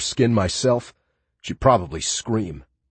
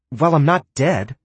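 higher-pitched, argumentative retort; mildly argumentative, pitched up; arguing; 7 semitones higher, 4 dB louder than usual. A narrator's reading. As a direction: push back as if disputing the point. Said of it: "she argued".

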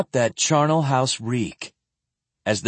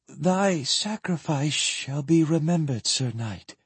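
higher, trembling, sinking to soft; 4 semitones higher, 4 dB quieter than usual. A narrator's reading. Sephrenia honors the dead knight, trembling. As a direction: let the voice tremble, fading at the end. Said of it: "she said in a trembling voice".

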